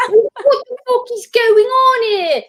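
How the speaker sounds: hammed-up outraged Australian star shouting on set; Russell Crowe. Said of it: "he's Australian, but he's like".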